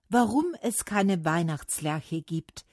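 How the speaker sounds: ordinary delivery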